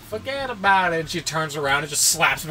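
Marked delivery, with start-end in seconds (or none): 0.1-1.0 higher-pitched New York brush-off; mock New York accent. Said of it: "We don't talk like that".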